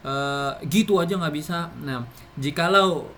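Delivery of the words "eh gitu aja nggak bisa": loud, higher impatient scolding; scolding demo. Shown as a demonstration. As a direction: snap at someone who can't do a simple thing. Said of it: "maka intonasi yang digunakan adalah intonasi tinggi".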